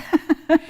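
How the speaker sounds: loud high burst of laughter. Real, not acted. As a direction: crack up at the bargain. Laughing at the bargain.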